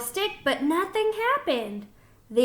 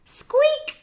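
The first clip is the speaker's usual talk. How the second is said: very high squeak; tiny squeaky mouse sound; 14 semitones higher than usual. A narrator's reading. Mouse boarding, a very high squeak. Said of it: "said Mouse".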